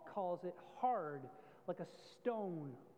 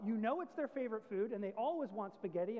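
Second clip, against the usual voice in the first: normal talking voice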